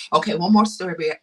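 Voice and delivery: ordinary talk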